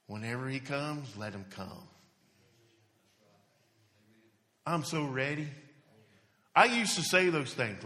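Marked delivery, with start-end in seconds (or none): 0.0-5.5 quiet, lower, calm surrender; a hint of quiet, settled trust. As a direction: let it go softly, at peace with it.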